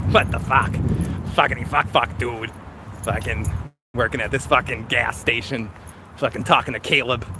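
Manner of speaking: winding down a mock Boston rant; Boston accent. Reviewer finishing his Boston take on Caleb, Boston accent. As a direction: grumble it out in a put-on Boston accent. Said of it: "There's a little Caleb with a Boston accent".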